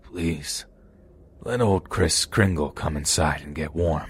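put-on slow, soft, eerie old man; haunting voice. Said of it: "He said in a slow and haunting voice".

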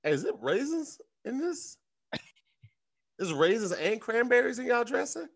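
poking at the dressing, mildly mocking; mock guest. Guest at dinner quizzing hosts about the dressing, mock suspicious. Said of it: "And he was like".

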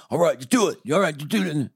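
tired parent. Retold or imitated. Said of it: "The second kid's like".

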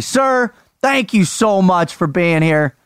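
ordinary talk, nothing marked

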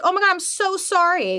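high and loud, flustered apology; embarrassed apology. Retold or imitated. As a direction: squeak out a mortified sorry. Apologizing after being caught.

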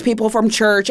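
normal talking voice

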